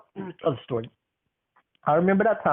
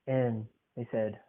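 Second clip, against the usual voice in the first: ordinary delivery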